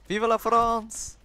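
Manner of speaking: high, slow, drawn-out cry of Vive la France; French battle cry. Pretend. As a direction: belt it out like a charging soldier. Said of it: "that was way too Russian".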